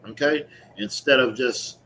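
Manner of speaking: ordinary talk, nothing marked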